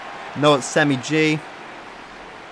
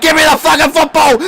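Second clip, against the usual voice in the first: high, loud mock demand; a hammed-up shout for the ball; imitating a player; 14 semitones higher, 9 dB louder than usual. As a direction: yell it as the angry teammate. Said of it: "Kama's like".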